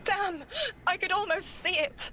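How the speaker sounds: high-pitched burst of exasperation; frustrated outburst. Acted. Seer who almost saw it, exasperated outburst.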